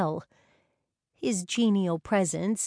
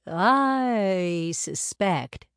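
put-on knowing older man; 4 dB louder, much slower than usual. Wilfred's voice in narration.

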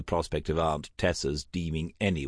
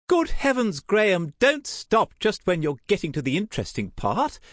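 high, loud aunt's protest; theatrical high-pitched exclamation; exclaiming; 5 dB louder than usual. A narrator's reading. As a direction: burst in, agog for gossip. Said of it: "Tessa exclaimed".

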